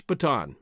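Bataan as "buh-TAHN"; preferred pronunciation. Shown as a demonstration. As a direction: say Bataan in two syllables ending in "ahn". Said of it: "I prefer to use".

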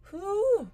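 a loud high whew holding back tears; teary exhale; emotional whew. Real, not acted. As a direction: blow out a breath to steady yourself. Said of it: "I'm about to tear up".

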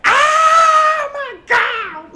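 drawn-out high shout of Oh my God; hammed-up ecstatic shriek; running down the hall shrieking. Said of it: "running up and down the halls".

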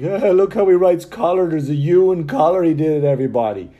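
loud, high, jeering schoolyard taunt; mocking American pointing out his spelling; mocking onlooker. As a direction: point and jeer at him to the crowd. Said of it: "didn't want people like".

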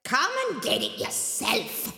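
high, cranky ogress voice; ogress calling back. Acted. Mama Draga answering from the well's bottom, cranky. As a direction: toss it back up the well.

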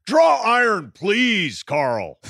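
high shouted Walton plea, dropping to plain on Carl; mock Walton shout at Karl Malone; imitating Bill Walton. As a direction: bellow the plea like an exasperated broadcaster. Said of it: "Walton, the quote".

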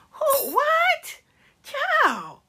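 very high, drawn-out disbelief; mock disbelief. Reacting to someone's invented scenario, mock disbelief. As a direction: squeal it in theatrical bewilderment.

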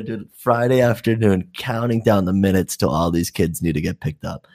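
ordinary talk

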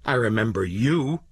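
sharp, biting accusation; understated savage anger. A narrator's reading. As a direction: throw it at her with fury. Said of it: "he said savagely".

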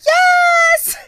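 extremely high, loud, grating excited squeal; Stacey Ann. Acted. Podcaster annoying co-host with a contestant's voice, grating high shriek. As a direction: shriek it as a hammed-up, piercing impression. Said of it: "I wanna do my Stacey Ann impression".

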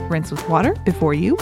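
usual speaking voice

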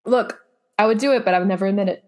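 usual speaking voice

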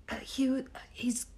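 hushed wildlife documentary narration; David Attenborough whisper. Pretend. Asked by her co-host to narrate the bird video, hushed documentary whisper. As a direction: whisper like a nature narrator watching prey. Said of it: "please be our David Attenborough".

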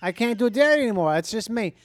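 put-on brash playful New York accent. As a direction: order coffee in a big New York accent. Playacts New Yorker ordering coffee.

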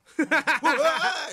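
"ふわー" drawn out very slowly, high and laughing; laughing gasp. Retold or imitated. Repeating his stunned reaction to his partner: "ふわー" slow, high, laughing. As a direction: stretch "ふわー" long and high through a laugh. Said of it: "言うたよ、俺".

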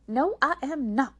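loud, high, emphatic denial; cross refusal. A narrator's reading. May May answers her sister before the show, crossly. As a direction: refuse with indignant force on every word.

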